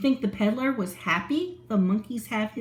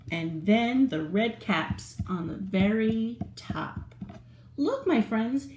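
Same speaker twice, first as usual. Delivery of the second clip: usual speaking voice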